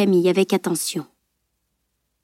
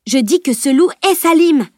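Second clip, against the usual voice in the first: loud, high, insistent; insistent exclamation; 7 semitones higher, 4 dB louder than usual. A narrator's reading. Camille insisting to the group, loud and high. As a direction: insist it over their doubt.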